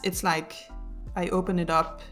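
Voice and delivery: normal talking voice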